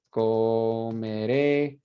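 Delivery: comeré drawn out very slowly, syllable by syllable; slow model word. Shown as a demonstration. Teacher models comeré very slowly for students. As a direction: stretch out comeré slowly so the class hears each syllable. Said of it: "Tenemos hablaré".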